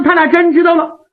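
usual speaking voice

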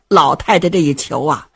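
ordinary delivery

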